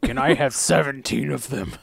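playful imitation of a slow growl; mock growl voice. Co-host copies the villain to order delight meals, mock growling. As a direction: drag it out in a hammed-up growl. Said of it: "That's a good growl voice".

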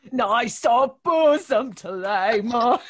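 loud, slow, hammed-up Cockney; imitated Cockney. Co-host mimicking the miner's line, loud drawn-out Cockney. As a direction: parody broad Cockney, stretching every vowel. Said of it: "that really offensive Cockney accent".

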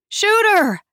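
high, urgent yell; yelled alarm. A narrator's reading. Someone yells running toward the store entrance, yelled alarm. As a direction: yell a panicked warning to everyone. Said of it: "someone yelled".

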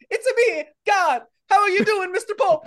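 high cartoon Italian accent; Mario. God answering the praying Pope, high Italian accent. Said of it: "Can you imagine the Pope praying".

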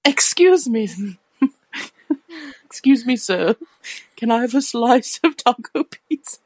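high, put-on customer voice; posh customer voice. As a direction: ham up a fussy diner order. Acts out ordering at a restaurant.

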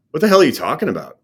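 sharp, high, fast disbelief; shocked outburst. Retold or imitated. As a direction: blurt it out in angry shock. Learning his roommate was killed.

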